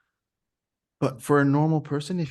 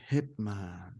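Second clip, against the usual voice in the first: hushed, frightened hitman; cowering plea; 6 semitones lower, 8 dB quieter than usual. Shown as a demonstration. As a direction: say it like you've seen a killer. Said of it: "Say it like you've seen a hitman and you're really, really scared".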